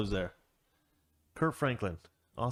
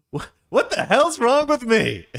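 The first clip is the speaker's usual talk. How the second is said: high, loud, slow indignant outburst; Jeffrey shouting in disbelief, high and loud; outraged Jeffrey; 12 semitones higher, 8 dB louder, slower than usual. Acted. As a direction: let the offence burst out. Jeffrey upset, offended.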